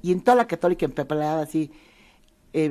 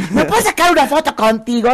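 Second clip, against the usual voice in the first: high, louder eager fan asking with a laugh; excited fan; 8 semitones higher, 5 dB louder than usual. Retold or imitated. Fans stopping cars to ask the comedian, excited request. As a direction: gush at the celebrity. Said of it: "paraban los autos".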